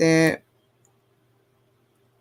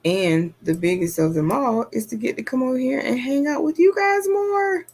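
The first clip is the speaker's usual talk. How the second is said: excited; 7 semitones higher than usual. Real, not acted. Excited about hanging out with viewers.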